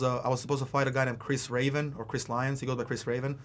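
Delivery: usual speaking voice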